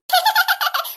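high, acted giggle; teasing laugh. Girl laughing at her sister, teasing giggle. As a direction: giggle at the squabble.

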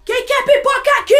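high, very loud crowd call; calling out, imitated. Star handing popcorn to fans, imitated loud call. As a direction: holler the offer to a whole crowd.

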